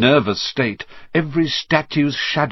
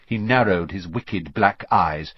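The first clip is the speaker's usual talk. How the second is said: plain reading voice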